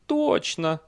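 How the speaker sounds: high-pitched horse voice; confirming horse. A narrator's reading. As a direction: agree brightly high as the horse. (высокий голос лошади)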